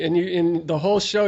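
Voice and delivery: ordinary delivery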